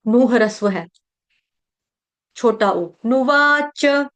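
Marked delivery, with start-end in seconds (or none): 3.1-4.1 नुवाच chanted with long वा, then a short च; slow नुवाच, nu-vaa-cha; stop after cha. Shown as a demonstration. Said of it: "च के बाद रुक जाएंगे".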